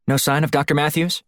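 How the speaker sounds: light female handler voice, concerned; Shields on comms. Acted. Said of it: "Shields asked".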